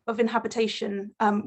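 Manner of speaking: ordinary delivery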